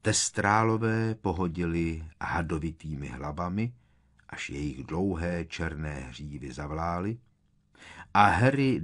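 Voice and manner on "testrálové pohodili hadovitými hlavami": plain reading voice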